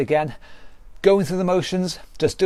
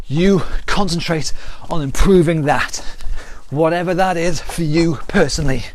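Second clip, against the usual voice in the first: higher, pumped-up calls over the exercise; workout coaching calls; 3 semitones higher than usual. Acted. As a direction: cheer them through the round. Calling out during a workout round.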